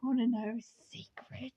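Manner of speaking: soft, breath-only whisper. A narrator's reading. Robot leaning in to the guest's ear, whispering. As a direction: whisper it secretively in someone's ear. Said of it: "whispering in my ear".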